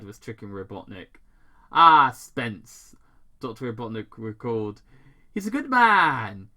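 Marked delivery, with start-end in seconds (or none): 1.7-3.0 booming, louder Robotnik greeting; loud villain greeting. Acted. Robotnik greeting Tom's buddy's name loudly. 5.3-6.5 higher, louder mock approval; hammy villain praise. Robotnik faking warmth to Tom, loud and high.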